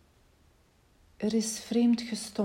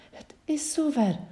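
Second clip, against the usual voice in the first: much higher, louder narrated exclamation; startled cry; 8 semitones higher, 5 dB louder than usual. A narrator's reading. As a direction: call it out high, in mild alarm. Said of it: "riep haar stem geschrokken". (geschrokken, hoge uitroep)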